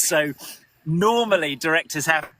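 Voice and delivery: normal talking voice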